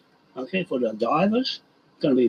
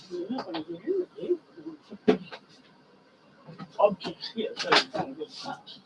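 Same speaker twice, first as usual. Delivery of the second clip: much higher and softer, pet talk; talking to the chicken; 11 semitones higher, 6 dB quieter than usual. Real, not acted. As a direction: coax the chicken, high and soft.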